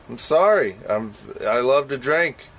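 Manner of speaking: usual speaking voice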